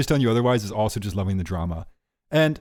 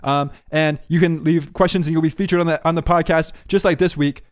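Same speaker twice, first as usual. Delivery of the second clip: upbeat, higher, louder promo voice; podcast plug; 5 semitones higher, 3 dB louder than usual. Acted. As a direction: sell it like an announcer.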